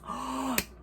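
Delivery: delighted gasp; big audible intake of breath. Real, not acted. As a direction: gasp at a juicy find. Reacts to next post.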